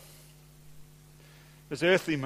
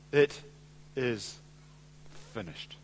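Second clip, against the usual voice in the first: halting words, each softer and lower; grave, fading last words; hushed solemn quote; 5 semitones lower, 9 dB quieter than usual. Retold or imitated. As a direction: pause after every word and sink to near silence.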